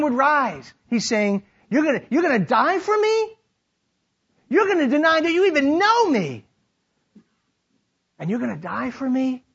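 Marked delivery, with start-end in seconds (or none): 1.7-9.4 doubting Jesus. Acted. Jesus questioning Peter's boast.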